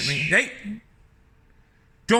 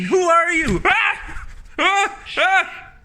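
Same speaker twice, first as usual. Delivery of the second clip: startled yell; 7 semitones higher, slower than usual. Real, not acted. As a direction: yell it, caught off guard. Startled man yelling at bird.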